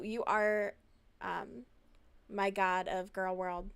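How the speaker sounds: normal talking voice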